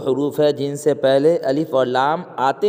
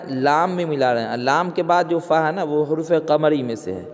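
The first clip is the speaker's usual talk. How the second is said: ordinary talk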